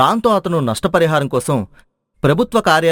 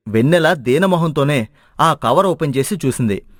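ordinary delivery, nothing marked

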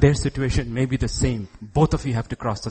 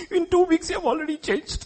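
very high mock-wifely whine; complaining newlywed wife. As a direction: imitate a new bride's high-pitched complaint. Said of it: "newlywed wives will complain to husbands".

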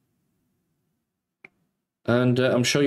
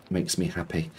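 soft repeat; 7 semitones lower, 7 dB quieter than usual. Real, not acted. Repeats line much softer.

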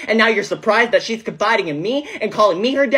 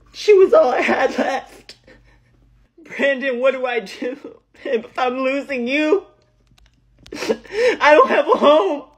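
sobbing, high and slow; breaking down in tears, slowed and high; 5 semitones higher than usual. Acted. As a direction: cry the words out, voice pitched up. Breaks down after losing everything.

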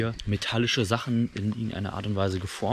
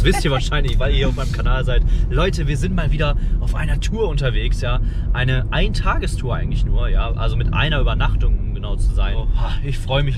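energetic vlog intro; 3 semitones higher, 7 dB louder than usual. Acted.

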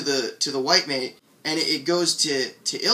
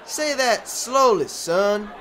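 high, slow, drawn-out order; heckler's demand; 7 semitones higher, 4 dB louder, slower than usual. Acted. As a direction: stretch it out condescendingly. Heckler orders the young speaker.

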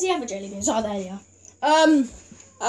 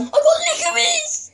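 loud shrieking laugh-cry; shouting; 4 dB louder than usual. Real, not acted. Gets worst flavour.

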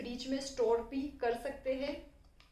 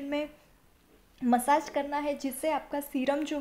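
talking while massaging face; 5 dB louder than usual. Real, not acted. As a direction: hand briefly over mouth. Seen 1: fingers rub around the mouth and chin; they cover it briefly at 1.7.

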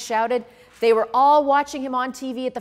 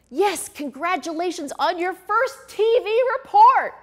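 high, loud congratulations; exclaiming Gil; 10 semitones higher, 4 dB louder than usual. A narrator's reading. Gil congratulating Freddie, high and loud. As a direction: exclaim it brightly.

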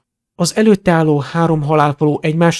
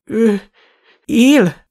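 high, halting shock; gaping disbelief; 7 semitones higher than usual. A narrator's reading. Shopkeeper learning the dark lord lives, gaping disbelief. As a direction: gasp it out haltingly, pitched high. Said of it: "Borgin percekig csak hápogott".

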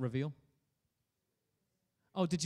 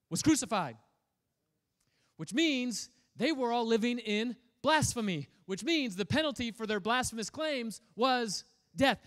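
higher and louder, driving the danger home; building intensity; 7 semitones higher, 6 dB louder than usual. Acted. Preacher to congregation, fired up about the disciples' fear. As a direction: preach it with rising urgency.

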